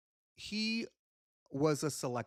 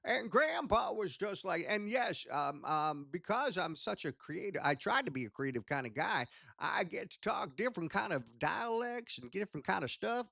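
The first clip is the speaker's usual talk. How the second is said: higher-pitched grandpa puppet character; Grandpa Cratchit; 6 semitones higher than usual. Shown as a demonstration. Puppeteer demonstrates an old puppet, high grandpa voice. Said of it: "used to talk like this".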